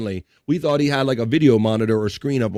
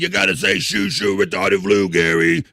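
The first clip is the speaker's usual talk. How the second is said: imitating Richard; 5 semitones lower, 4 dB louder than usual. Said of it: "And Richard said".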